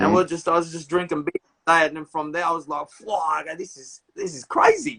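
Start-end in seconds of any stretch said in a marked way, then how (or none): 2.9-5.0 retold awe, pitch climbing much higher; amazed exclamation. Hearing the album for the first time, high amazed outburst. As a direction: let the amazement lift the voice up high.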